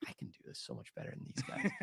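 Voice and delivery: hushed, cocky mock boast. Contestant-to-be scoffing at the credits roll, mock cocky. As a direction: boast it under your breath, lightly mocking your younger self. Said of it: "I'm so cocky still".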